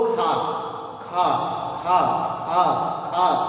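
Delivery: खास repeated with a clean final s, paused between; teacher's pronunciation drill. Shown as a demonstration. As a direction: drill खास word by word, each ending in s. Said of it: "उस शिक्षक ने ये बोलना सिखाया". (खास को "स" के साथ दोहराकर सिखाना)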